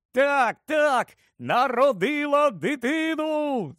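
high, drawn-out elated yell; joyful yelling. A narrator's reading. Lupin confirms the birth, yelling with joy. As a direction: holler the confirmation, stretching it out. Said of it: "зарепетував Люпен".

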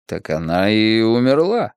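ordinary delivery, nothing marked

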